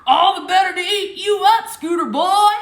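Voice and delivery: loud, high roar; roaring monster. Acted. The truck drops the disguise and roars at Little Red, loud. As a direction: roar it to scare him. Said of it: "roared Tank".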